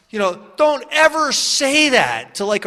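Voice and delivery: normal talking voice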